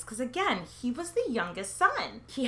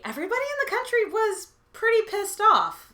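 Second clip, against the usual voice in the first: higher, louder indignant burst; outraged; 12 semitones higher, 5 dB louder than usual. Acted. Telling viewers the nation was furious, indignant. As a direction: let the anger of the country spill out.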